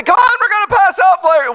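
high, loud pushy invite; rally her excitedly; eager call. Retold or imitated. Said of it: "I would say".

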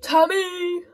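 shouted name, high and loud; calling out. Real, not acted. Gamer calling a teammate by name, loud. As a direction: call Tommy across the pitch.

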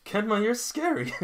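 high, loud squeal of fright; playful high-pitched yelp at a teammate; mock scared shout. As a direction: shriek it in mock fear. Reactor voices character's shout.

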